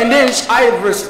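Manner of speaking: ordinary talk, nothing marked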